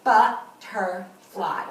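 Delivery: "Butterfly" very slow in three beats, But, ter, fly; "Butterfly" chopped into three separate syllables. Shown as a demonstration. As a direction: count out "Butterfly" with a pause after each syllable. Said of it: "how many syllables are in the word butterfly".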